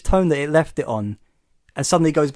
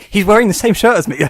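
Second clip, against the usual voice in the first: higher, louder mock narrator aside; personal narration; 6 semitones higher, 8 dB louder than usual. Retelling the narrator's personal aside, higher and louder. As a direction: blurt it like a narrator going off script. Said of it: "his narration suddenly gets all personal".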